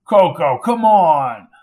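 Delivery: loud. Acted. Bystander protests.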